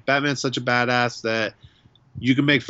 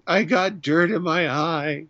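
higher, slower fake-casual cover-up; mock excuse; 6 semitones higher, slower than usual. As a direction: pretend nothing's wrong while choked up. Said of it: "I'm like".

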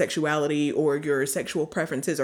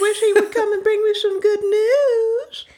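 mock tearful lament; 6 dB louder than usual.

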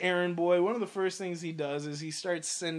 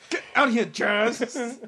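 higher, louder mock yell throwing him out; 5 semitones higher, 5 dB louder, slower than usual. Retelling the Fresh Prince scene with a mock yell. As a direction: bellow it like an annoyed uncle kicking Jazz out. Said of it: "Fresh Prince style".

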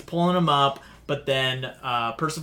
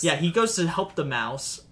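ordinary delivery

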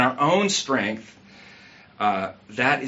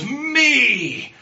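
high, loud self-cheering cry of a footballer; cocky footballer crowing me, pitched way up; Jamie Tartt gloating; 11 semitones higher, 4 dB louder than usual. Retold or imitated. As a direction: shout me like a showboating striker. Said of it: "he goes".

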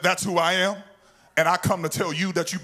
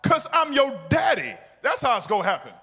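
stern parent; 6 semitones higher, faster than usual. Acted. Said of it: "It's like you telling your, your kid".

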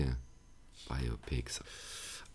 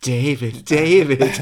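movie quote voice; 6 semitones higher, 13 dB louder, much slower than usual. Retold or imitated. Repeats Independence Day quote.

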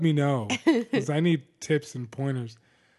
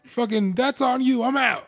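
mock defiant; 9 semitones higher, 3 dB louder than usual. Acting out his departure.

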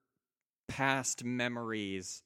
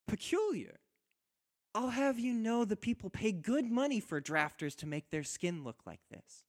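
high, teasing wife's voice with a pout; mock offence, pouting it out high; playful pout; 11 semitones higher than usual. Wife teasing her husband on the beach, high and pouting. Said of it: "She gives you a playful pout".

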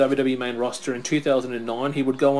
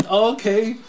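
acted-out reply; 9 semitones higher, 5 dB louder than usual. Acts out the other person's reply.

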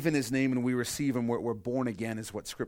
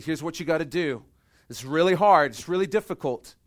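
louder, higher urgent plea; earnest appeal; 4 semitones higher, 8 dB louder than usual. Acted. God telling people the hard requirement, loud and urgent. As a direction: press it home like a pleading father.

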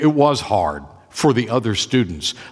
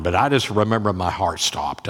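ordinary delivery

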